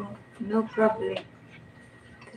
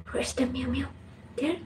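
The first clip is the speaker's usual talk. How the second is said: whisper; 10 dB quieter, faster than usual. Real, not acted. Whispering to child about cat.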